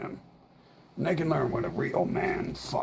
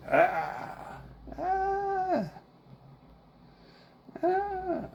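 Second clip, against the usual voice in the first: high wail; much slower than usual. Real, not acted. Held high cries.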